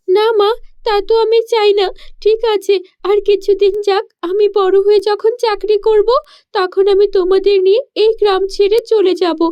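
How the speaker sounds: put-on a poor village girl puzzling aloud; young girl voice.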